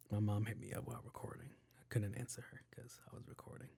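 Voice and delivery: quick whispered aside. Real, not acted. Aside about his mom calling.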